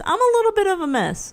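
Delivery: sing-song little bit of a mess; playful confession. Pretend. Admits being a mess twice.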